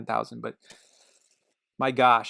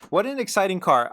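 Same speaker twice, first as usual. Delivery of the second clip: higher and louder, full enthusiasm; excited; 6 semitones higher, 6 dB louder than usual. Shown as a demonstration. Car fan gushing over the Infiniti, excited and loud. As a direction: burst out with the praise.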